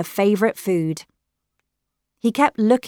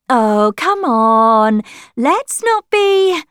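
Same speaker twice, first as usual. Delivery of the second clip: loud, high, drawn-out objection; exasperated protest; 7 semitones higher, 8 dB louder, much slower than usual. A narrator's reading. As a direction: stretch it out, exasperated, trying to stop the row. Della protests at Lily's outburst.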